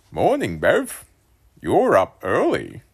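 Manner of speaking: high, loud puzzled dad voice; questioning dad. A narrator's reading. Dad surprised to see his son up early, high and puzzled. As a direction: greet him like it's a question. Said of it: "He said it like a question".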